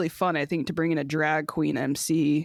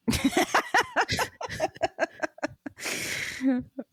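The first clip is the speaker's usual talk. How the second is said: high cackling witch laugh; 10 semitones higher, 3 dB quieter than usual. Acted. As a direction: cackle like an old hag. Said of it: "Were you trying to do a witch laugh?".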